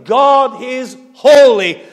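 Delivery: slow, loud, every word weighted; emphatic proclamation. Real, not acted. Preacher to congregation, slow and forceful. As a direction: drive home that God is holy.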